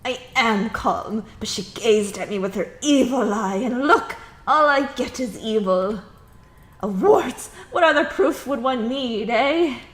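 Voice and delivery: loud, high, indignant ranting; furious complainant raging about a wart; not calm at all. Acted. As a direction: insist you're calm while shouting. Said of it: "Please continue, and calm down".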